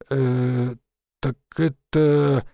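slow, drawn-out hesitation; drawling. A narrator's reading. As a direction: stretch each word, stalling for an excuse. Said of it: "протянул Васян". (растерянно тянет слова)

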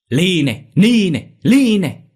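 loud, high bursts, each one climbing; a shout with every kick; furious shouting. Acted.

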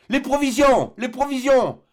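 high, loud repeated cry. Acted. Doctor crying out to jettison the food, high loud shout. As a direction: shout for the provisions to go overboard. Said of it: "s'écria le docteur".

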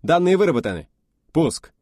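high, loud snappy report; brisk reply. Acted. Officer reporting to the test leader, loud brisk reply. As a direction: rap it out smartly.

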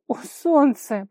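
loud, high, drawn-out lament; anguished moan. A narrator's reading. Vara appealing to the heavens, moaning. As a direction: groan it up to the sky. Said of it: "простонала она".

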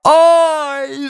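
high, loud yell of pain; piercing wail; screamed "oy". A narrator's reading. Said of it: "завопил он".

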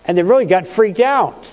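normal talking voice

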